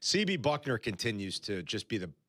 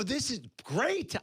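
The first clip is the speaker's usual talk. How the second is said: slow high amazed delight; drawn-out gleeful "great"; excited; 11 semitones higher, slower than usual. Retold or imitated. Watching a pizza video, amazed and delighted. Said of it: "I go".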